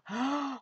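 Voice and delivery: a short high intake of breath in delight; surprised gasp. Real, not acted. Finding an old church songbook, sharp delighted gasp. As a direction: gasp at the discovery.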